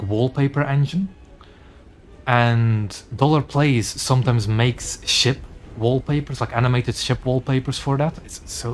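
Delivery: ordinary talk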